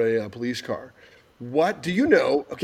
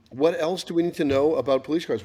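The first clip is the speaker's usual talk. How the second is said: normal talking voice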